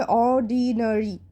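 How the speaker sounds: "অর্ডিনারি" slowly, stretched part by part; syllable-by-syllable demo. Shown as a demonstration. Teacher showing pupils how "অর্ডিনারি" is pronounced, slow and divided. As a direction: draw out each syllable of "অর্ডিনারি". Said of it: "উচ্চারণ কীরকম হবে".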